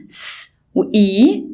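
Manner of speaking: slow س وإي with a long ee; slow blend. Shown as a demonstration. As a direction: join the hiss to ee slowly. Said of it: "اللونج فاول ساوند اسمه اي".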